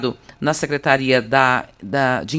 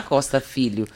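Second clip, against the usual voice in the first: ordinary talk, nothing marked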